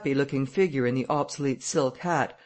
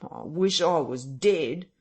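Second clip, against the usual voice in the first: despairing whine; 3 semitones higher, slower than usual. A narrator's reading. Marvel despairs.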